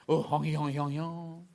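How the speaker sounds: playful fake French babble, lightly done; mock French gibberish. Host joking with viewers about Paris, mock French gibberish. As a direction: babble nonsense like a jokey French speaker. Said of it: "That's my French".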